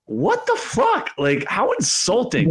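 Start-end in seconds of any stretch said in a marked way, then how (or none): 0.1-1.1 high, slow disbelieving what the fuck; incredulous. Real, not acted. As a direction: stretch it out, stunned and outraged. Outraged disbelief.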